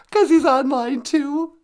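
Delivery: very high squeaky question. Acted. The sidekick squeaks a name at the host. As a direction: chirp it like a puppet.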